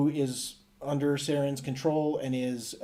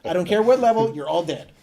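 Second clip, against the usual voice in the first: loud, raised-pitch hammed-up verdict; mock ruthless anger; 5 semitones higher, 9 dB louder than usual. Player condemning the infected colonists, mock angry. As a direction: lay down the law in mock fury.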